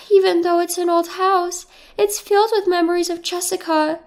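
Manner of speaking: a hint of a sad older mother; lightly voiced mother, sad to leave the house; mother's voice. A narrator's reading.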